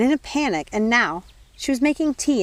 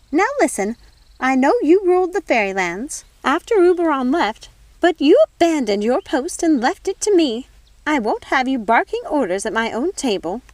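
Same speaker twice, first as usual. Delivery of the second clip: higher and louder, scolding her sister; indignant fairy godmother; 8 semitones higher, 6 dB louder than usual. Acted. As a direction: put her sister in her place at your table.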